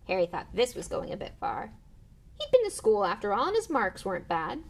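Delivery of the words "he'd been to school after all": usual speaking voice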